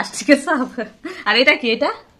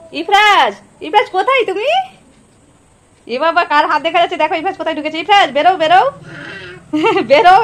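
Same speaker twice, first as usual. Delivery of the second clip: playful calling; 6 semitones higher than usual. Real, not acted. Calling hiding child playfully.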